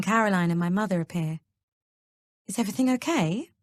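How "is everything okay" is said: higher, worried question from the doorway; anxious. A narrator's reading. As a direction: ask it with nervous concern. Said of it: "Mum says anxiously".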